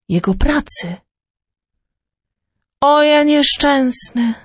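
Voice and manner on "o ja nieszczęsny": lament. Acted. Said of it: "lamentował Fortunato".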